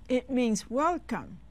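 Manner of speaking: ordinary delivery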